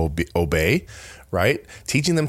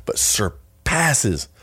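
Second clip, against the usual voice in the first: "surpasses" drawn out very slow and leaned on; slow right down and hit "surpasses"; stress on surpasses; much slower than usual. Shown as a demonstration. Correcting herself mid-verse, slow weighty stress on "surpasses". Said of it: "hold on, not just passes".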